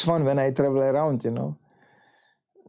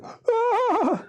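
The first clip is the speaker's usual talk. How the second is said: startled yelp. Retold or imitated. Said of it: "And I was like".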